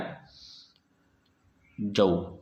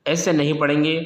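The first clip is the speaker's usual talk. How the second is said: usual speaking voice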